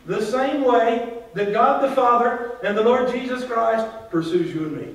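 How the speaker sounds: full-voiced pulpit swell; rising preaching. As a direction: preach it up with growing fire. Preaching builds to full force.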